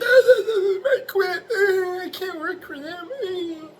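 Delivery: very high, wailing fake sobs with laughter; hammed-up whimpering of a crybaby who quits; mock crying quitter. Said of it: "I'm not like".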